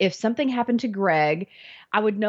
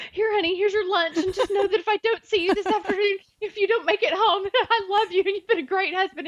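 much higher, melodramatic goodbye, half laughing at herself; overdramatic farewell; 11 semitones higher than usual. Retold or imitated. Wife seeing her husband off to work as if for the last time, hammed-up and much higher with laughs. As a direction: ham up a tearful last goodbye, breaking into laughs. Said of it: "making this big production".

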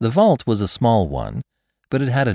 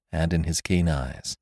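ordinary delivery

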